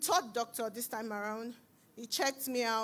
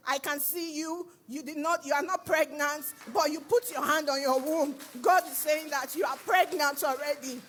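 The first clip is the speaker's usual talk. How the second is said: louder, rising prophetic preaching; 3 semitones higher, 4 dB louder than usual. Retold or imitated. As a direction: proclaim it like the pastor at the altar. Pastor prophesying to congregation.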